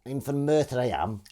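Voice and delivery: put-on Welsh valleys accent, lifted; Merthyr Welsh accent. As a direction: play a local from Merthyr, Welsh lilt. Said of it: "Where you from?".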